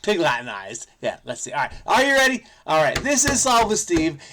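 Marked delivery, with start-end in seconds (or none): 1.8-4.2 loud, higher game-show opener; announcer voice opening Solve with Steve; show intro. Acted. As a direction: hype up the show's opening. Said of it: "show intro".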